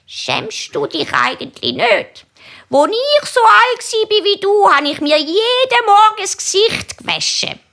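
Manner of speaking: hohe Grossmutterstimme, die schimpft; scheltende Grossmami. A narrator's reading. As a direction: schimpf wie eine empörte Oma, hoch und gedehnt. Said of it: "Dann schimpft die Grossmami". (hoch schimpfendi Grossmami-Stimm)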